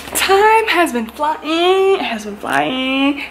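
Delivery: slow, high, stretched "time has been flying"; drawn-out amazement. Real, not acted. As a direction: marvel at it, dragging each word out. Marveling at the time passing.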